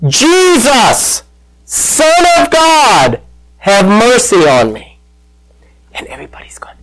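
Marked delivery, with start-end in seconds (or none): 0.1-5.0 drawn-out, high-pitched call without real volume; imitated yell. Blind beggar to passing Jesus, a retold yell. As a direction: call out high and stretched, easing down on the plea. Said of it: "he yells out".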